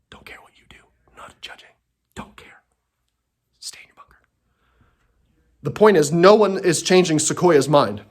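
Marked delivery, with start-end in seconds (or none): 0.1-4.2 faint whispered asides; hushed whisper under the breath. Real, not acted. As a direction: breathe it almost silently. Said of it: "whispered asides".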